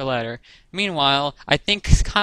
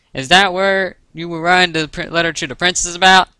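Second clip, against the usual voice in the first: put-on louder, higher country-accent question; Applejack's country accent; 5 semitones higher, 6 dB louder than usual. A narrator's reading. Applejack asks about the letter, country accent. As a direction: ask nosily in a country twang. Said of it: "in her unmistakably country accent".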